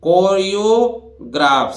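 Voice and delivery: कोरियोग्राफ said slowly in two halves, कोरियो then ग्राफ; split in two parts. Shown as a demonstration. Teacher to students, कोरियोग्राफ split slowly in two parts. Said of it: "दो हिस्सों में तोड़िए".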